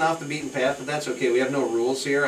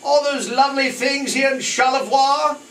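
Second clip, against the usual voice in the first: a hint of a posh Englishman listing rude songs, higher; mock English gent; 12 semitones higher, 4 dB louder than usual. Host lightly imitating [name]'s posh voice about dirty songs. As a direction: put on a lightly proper English air. Mocking the singer's dirty lyrics.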